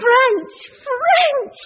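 high excited exclamation; excited cry. Acted. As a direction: squeal with delight. Maria excited at French speech.

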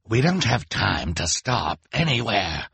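sharp, snarling refusal; sharp villain. A narrator's reading. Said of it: "Olaf said sharply".

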